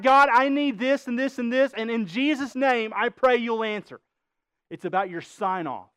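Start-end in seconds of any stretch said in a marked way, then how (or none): 0.0-4.0 loud, high mock demanding prayer; imitated forceful, routine petition; mock rote prayer. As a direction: rattle off a wish-list prayer. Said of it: "Do you see the difference?".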